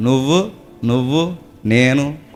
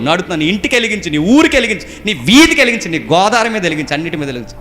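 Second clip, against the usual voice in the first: high, loud commands driven home; full-force preaching; 7 semitones higher, 3 dB louder than usual. Real, not acted. Preacher commands believers to light up town and street, full-force preaching. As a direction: build repeated commands into a pulpit cry.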